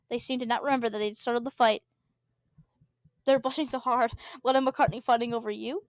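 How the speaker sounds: usual speaking voice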